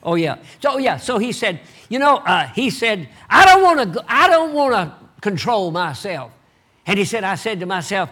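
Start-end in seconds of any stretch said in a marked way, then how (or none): 3.3-6.3 high, loud whiny protest; the rebellious self throwing a fit; mock tantrum. The inner self refusing to calm down, loud tantrum.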